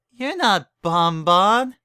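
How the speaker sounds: groggy slurred voice. Acted. Groggy Berry realizes.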